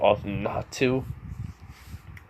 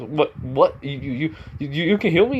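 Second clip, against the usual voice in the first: higher, stammering mock disbelief; stunned stammer; 5 semitones higher than usual. Student hears he can be healed, stunned stammer. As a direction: stumble over the words, rising in acted astonishment.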